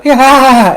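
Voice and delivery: pained groan. Retold or imitated. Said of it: "you just hear the".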